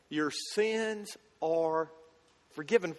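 your "sins" drawn out, very slow; spaced-out, weighty "forgiven"; slow emphatic quote. Retold or imitated. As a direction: let each word of "Your sins are forgiven" land slowly.

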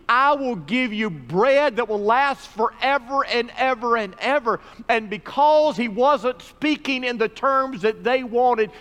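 high, loud, driving proclamation; forceful preaching. Acted. Preacher voices Jesus' promise at full force.

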